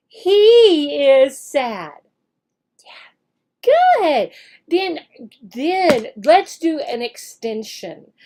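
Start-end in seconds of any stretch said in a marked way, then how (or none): 0.1-2.0 slow and loud model answer; slow modeled sentence. Shown as a demonstration. Teacher models the answer, slow and loud. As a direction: drag it out so the child follows. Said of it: "teacher acts out the lesson".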